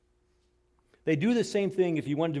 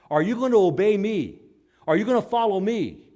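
rising, louder pleading questions; pleading challenge; 5 semitones higher, 6 dB louder than usual. Acted. Preacher challenging the congregation, louder each time. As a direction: press the crowd with each question.

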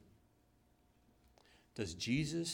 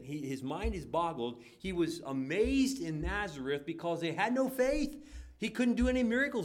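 loud, raised preaching voice; fiery preaching; 7 semitones higher, 6 dB louder than usual. Acted. Pastor preaching loud and high to the congregation.

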